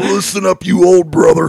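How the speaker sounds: hyped wrestler trash talk; wrestling promo. Acted. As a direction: growl it like a ring promo. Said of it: "Cut it like a wrestling promo".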